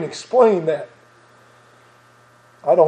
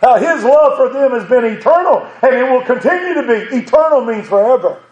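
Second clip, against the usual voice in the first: raised, driving sermon voice with bursts of laughter; fervent preaching; 5 semitones higher than usual. Acted. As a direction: preach it out with joy to the congregation. Preaching builds up.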